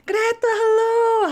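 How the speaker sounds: high, loud, drawn-out hello; loud, slow singsong greeting; effusive greeting. Acted. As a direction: stretch out a big cheery hail. Character hails Greta in play.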